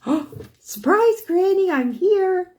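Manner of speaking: imitating an excited grandchild, high and loud; gleeful surprise. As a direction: squeal it slowly as a child jumping out of hiding. Grandson jumps out to surprise Granny.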